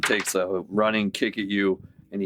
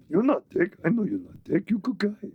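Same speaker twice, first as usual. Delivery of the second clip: much higher dopey orc; 11 semitones higher than usual. Acted. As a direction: reassure a friend like a big simple brute. Orc comforting his friend.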